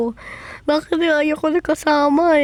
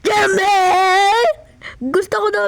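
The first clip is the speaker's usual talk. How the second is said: high, tearful call; crying out; 7 semitones higher than usual. Acted. Crying out for Jemmy in pretend play. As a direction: wail the name through tears.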